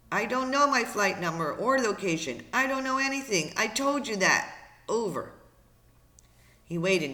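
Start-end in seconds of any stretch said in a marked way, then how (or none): 0.1-5.3 high, exasperated protest; frantic frustration. Acted. Brian answers operator's repeated questions, frantic frustration. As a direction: protest at a raised pitch, at wit's end.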